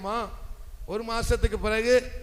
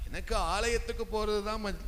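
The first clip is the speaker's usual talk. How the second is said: ordinary delivery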